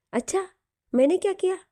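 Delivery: high, teasing innocent question; mock surprise. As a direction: play innocent, voice jumping up.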